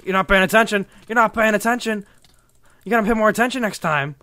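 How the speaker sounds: loud, high taunt; gloating shout. Real, not acted. Player to the opponent he caught unawares, gloating shout. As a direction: yell it at an inattentive opponent.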